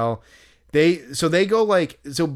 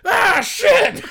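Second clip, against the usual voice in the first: much higher, loud exasperated shout; frustrated curse; 7 dB louder than usual. Retold or imitated. Fan walking out of the corral, loud frustrated curse. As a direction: spit it out as you walk off beaten. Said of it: "the best thing ... he goes".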